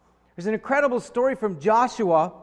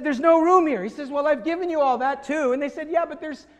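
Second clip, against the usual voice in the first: whiny complaint; 6 semitones higher than usual. Retold or imitated. Tribes complaining to Joshua.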